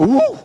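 high, short "woo" on coming up; mock gasping whoop. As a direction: whoop as if bursting up out of water. Eunuch surfacing from baptism.

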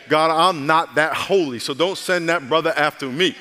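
loud, emphatic comic protest to God; mock plea. Preacher jokingly begs God to spare him, loud mock plea. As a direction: plead loudly, playing up the reluctance.

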